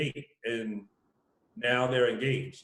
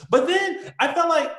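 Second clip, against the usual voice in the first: usual speaking voice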